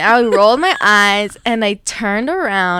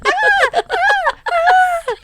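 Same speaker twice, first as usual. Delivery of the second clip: repeated high shrieks, one per pull; screaming aunts; 3 dB louder, much slower than usual. Retold or imitated. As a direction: yelp in high staccato bursts like the aunts. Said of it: "my tias are just screaming".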